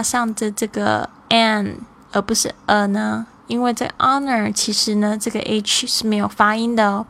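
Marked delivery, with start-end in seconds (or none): none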